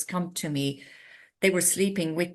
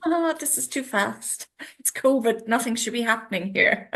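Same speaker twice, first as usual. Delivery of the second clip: high, animated protest at it all; mock alarm; 7 semitones higher, 4 dB louder than usual. Host recalling renovations racing ahead in covid, mock alarm. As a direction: play up the overwhelm, pitched well up. Acting out alarm at rapid changes.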